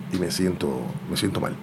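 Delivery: soft, low admission of feeling sick; feeling unwell. Retold or imitated. Retells telling clerk he feels ill. (diciéndolo bajito, como sintiéndose mal)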